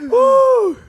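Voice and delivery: loud high whoop. Real, not acted. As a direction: let out a big cheer. Excited whoop.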